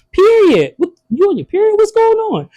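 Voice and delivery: high, incredulous questions; mock baffled. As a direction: act thrown by the slang. Daughter's slang then mock reply.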